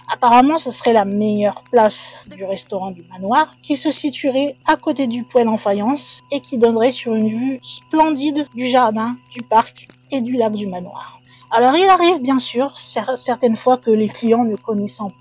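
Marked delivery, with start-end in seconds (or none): none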